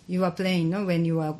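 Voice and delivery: usual speaking voice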